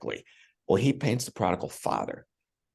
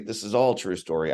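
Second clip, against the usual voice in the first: ordinary delivery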